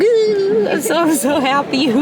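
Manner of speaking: high, giggly kicking-feet squeal; giddy squeal. Real, not acted. Fangirl squealing to the camera over her happiness. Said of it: "I wanna kick my feet".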